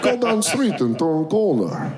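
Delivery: put-on flat blunt Russian accent; curt directions in Russian accent. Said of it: "ask a Russian for directions".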